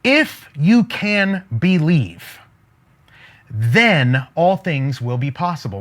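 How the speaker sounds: If stretched slow and lifted, long pause before then; stress on IF. Shown as a demonstration. Preacher shows Jesus' real wording, slow stress on If. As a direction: lean hard on If you can believe, then pause. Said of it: "by saying it like this".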